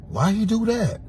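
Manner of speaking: squeaky outraged why; indignant squeal. Real, not acted. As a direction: ask it in a high squeak of disbelief. Indignant repeat of question.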